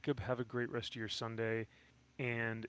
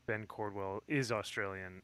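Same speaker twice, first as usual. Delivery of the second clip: ordinary talk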